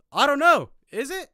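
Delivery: very high, loud retort; imitated cheeky shouted comeback; shouting back. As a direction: holler it back across the water. Friend shouts back at neighbour.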